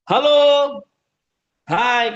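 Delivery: high, loud call-out; rallying yel-yel. Shown as a demonstration. Trainer calling participants to answer, rallying chant voice. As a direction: cheer out a call and response. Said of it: "kita juga bisa gunakan yel-yel".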